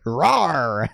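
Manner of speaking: drawn-out mock roar, rising then falling; imitated robot roar. Robot transforming once the pilot climbs in, mock roar. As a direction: roar like a giant robot powering up. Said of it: "then it goes".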